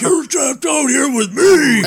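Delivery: loud, slow, teasing yell; playful shout. Pretend. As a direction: boom it out as a mock threat. Playful threat at co-host.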